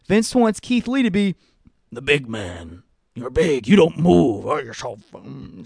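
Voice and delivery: gruff mocking boss impression, lightly done; a hint of a bossy promoter; mock Vince voice. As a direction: talk down to him like a dismissive boss. Mocking Vince's view of Keith Lee.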